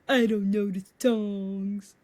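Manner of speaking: slow, drawn-out complaining whine; whiny boyfriend mimic. Girlfriend mimics boyfriend sulking at the movie. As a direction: mimic his sulky complaint. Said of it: "And he was".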